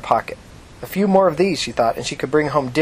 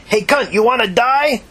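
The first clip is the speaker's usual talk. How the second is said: high, loud, slow menacing shout; threatening shout; 10 semitones higher, 4 dB louder, slower than usual. Acted. Prostitute storming over to threaten Tina, slow high shout. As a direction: hurl the threat at her. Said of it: "she shouted".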